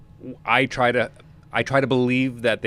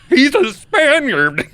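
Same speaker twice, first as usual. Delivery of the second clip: much higher, louder, slow exclamation; loud slow mock protest; 10 dB louder, much slower than usual. Speaker protesting about Hopkins' casting in a loud slow voice. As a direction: stretch it out in laughing disbelief. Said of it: "And I was like".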